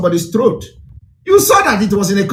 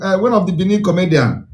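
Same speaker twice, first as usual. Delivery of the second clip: normal talking voice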